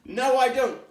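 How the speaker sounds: high, loud playful denial; emphatic no. Real, not acted. Instructor joking to viewers about her jogging, loud and high. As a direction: knock down the idea with a laugh in the voice. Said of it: "Do I look like Usain Bolt".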